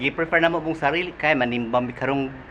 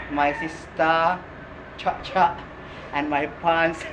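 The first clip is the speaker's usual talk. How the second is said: usual speaking voice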